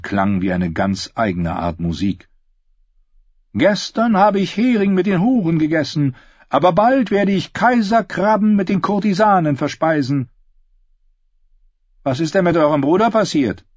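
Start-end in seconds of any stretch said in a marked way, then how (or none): 3.5-10.3 high, swaggering singer's voice; boastful singer Darion. Acted. 12.0-13.6 high, quick questioning voice; inquisitive Cat. Acted.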